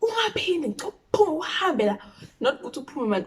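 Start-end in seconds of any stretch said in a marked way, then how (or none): 0.0-2.0 high, mock-furious order to leave; yelling mom. Mother ordering the friend away, mock yelling. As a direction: throw her out, high and sharp. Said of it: "she's yelling".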